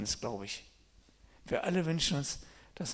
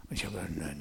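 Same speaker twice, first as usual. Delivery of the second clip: usual speaking voice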